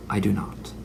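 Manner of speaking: ordinary talk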